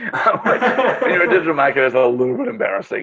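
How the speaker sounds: high, laughing embarrassment; laughing at himself. Real, not acted. Admitting bad forecasting to the host, laughing. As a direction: laugh through the admission. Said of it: "I'm really bad at forecasting".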